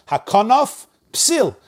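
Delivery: Hakanaf, slow and high, then psil; pause between words. Shown as a demonstration. Teacher showing a little pause, Hakanaf and psil apart. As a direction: finish the F of Hakanaf before psil. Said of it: "A little pause".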